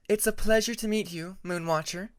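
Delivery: bow and greet with courtesy; lightly voiced polite Tempest.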